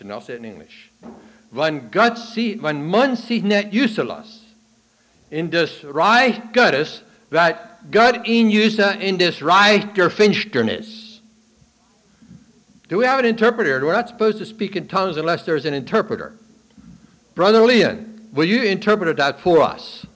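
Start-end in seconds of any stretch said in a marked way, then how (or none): 1.5-11.2 bishop's saying in Dutch, louder and higher, slow; Pennsylvania Dutch quote. Retold or imitated. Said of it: "I'm gonna say that again in Dutch". 12.9-20.1 much louder and higher, teasing the crowd; loud joking call. Real, not acted. Preacher jokingly asks congregation for an interpreter, loud.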